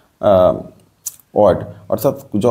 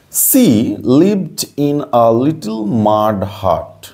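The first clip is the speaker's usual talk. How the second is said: slow read-out; much slower than usual. Shown as a demonstration. Teacher reading a new English sentence to pupils, very slow.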